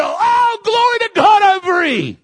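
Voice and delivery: high, loud shout of joy; joyful cry. Retold or imitated. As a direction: cry out free at last. Voicing a freed believer's cry.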